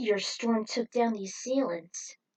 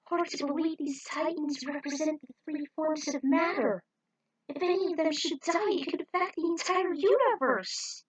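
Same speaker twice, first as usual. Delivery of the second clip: worried character voice; 7 semitones higher than usual. Acted. Character voice fearing titans.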